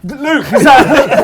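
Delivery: drawn-out, loud, high call with laughter in it; mock anxious call. Friend calls to roommate from bed, mock anxious call.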